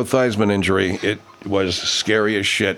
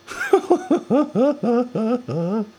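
long, high-pitched burst of laughter. Real, not acted. Laughing at the play.

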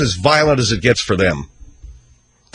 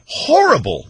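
emphatic repeat; 12 semitones higher, slower than usual. Real, not acted. Host repeats verdict on Scarface.

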